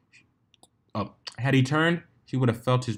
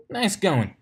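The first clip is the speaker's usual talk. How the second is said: chimed; 9 semitones higher than usual. A narrator's reading. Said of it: "the female Wonderbolt chimed".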